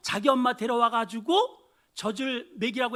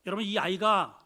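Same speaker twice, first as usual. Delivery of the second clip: normal talking voice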